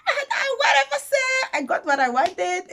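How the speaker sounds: high, loud, giddy dismissal; triumphant high-pitched outburst; gleeful brush-off. Retold or imitated. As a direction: squeal it out, too thrilled to care. Retold gleeful brush-off to the CEO.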